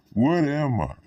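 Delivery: high-pitched, insistent questioning; retold childhood question. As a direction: ask it as a big question, pitch leaping up. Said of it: "I asked".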